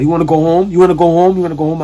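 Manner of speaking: loud, high repeated yell to break up a fight; coach yelling. Retold or imitated. Coach pulling him off a fight, yelling. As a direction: bark it at the kid like an angry coach.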